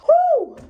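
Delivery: sudden high, loud whoop. Acted. Picturing how mad she would be, a wild whoop. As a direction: let out a wild whoop of disbelief. Imagining her own rage.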